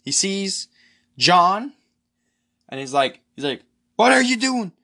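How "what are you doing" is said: high, loud retold yell; imitated panicked yelling. Krug yelling at John on waking, high imitated yell. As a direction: act out a scared man yelling at his captor. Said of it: "He starts yelling and screaming".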